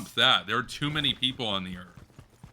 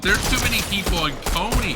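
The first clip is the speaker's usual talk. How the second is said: high, loud exclaim; excited shout; 9 semitones higher, 6 dB louder than usual. Real, not acted. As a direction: blurt it out loud. Shouting the game map name.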